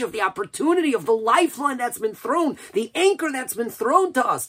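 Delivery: high, loud, impassioned exhortation; preaching at full force. Real, not acted. Preacher urging us to take the lifeline thrown, impassioned full-force preaching. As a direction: build to a crying pitch and hold it there.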